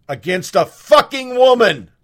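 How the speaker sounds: angry. Real, not acted. Ranting about campus double standards.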